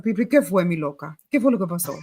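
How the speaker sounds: joking deep gossip voice. Shown as a demonstration. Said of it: "deeper voice".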